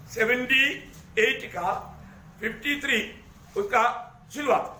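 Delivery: verse reference in separated chunks with pauses; dictating, piece by piece. Real, not acted. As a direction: read it out for people looking it up, pausing after each part. Repeats reference for people finding it. (टुकड़ों में, रुक-रुक कर बोलना)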